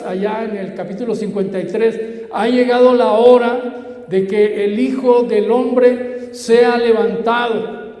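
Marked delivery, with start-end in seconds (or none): 2.3-8.0 loud, high, slow declamation; full-force preaching. Real, not acted. Preacher proclaiming the Son of Man lifted up, slow loud preaching. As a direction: ring it out slowly and loudly.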